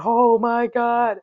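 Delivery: high, loud, slow disbelieving cheer; gasping out the victory; high triumphant yell, still out of breath; elated relief. Retold or imitated. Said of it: "just like".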